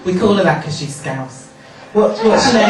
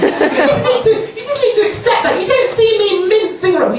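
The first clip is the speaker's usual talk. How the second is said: much higher, camp mock rant; 13 semitones higher than usual. Comic retells scolding a caller, much higher and camp. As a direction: lecture her in a squeaky mock voice.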